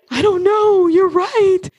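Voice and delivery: high, whiny, mock-crying give-in; mock cowering whimper. Cowering before her own brain, a half-crying mock whimper. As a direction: whimper it like you're backing down. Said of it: "Instead of cowering".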